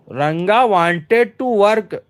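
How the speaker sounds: very slow, stretched reading of the answer sentence; slow read-aloud. Shown as a demonstration. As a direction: drag each word out for the class. Teacher rereads the answer sentence.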